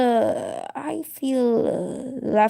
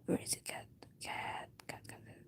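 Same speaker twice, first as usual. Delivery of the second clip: breathy whispered call for the cat, fading; whisper-call the cat, getting softer; 23 dB quieter than usual. Real, not acted. Searching for her cat, whispering to it.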